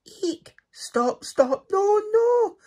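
a tiny mouse squealing in growing panic; panicked shrieks. A narrator's reading. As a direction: shriek in rising terror. Mice panic at the bear.

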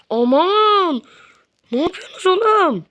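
high, loud cry of alarm; exasperated yell. Real, not acted. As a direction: shriek at the defenders. Near goal conceded, yelling.

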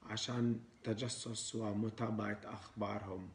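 put-on foreigner speaking broken Arabic with an accent; Arabic with a Western foreign accent. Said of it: "إحنا لازم نحكي عربي مكسر".

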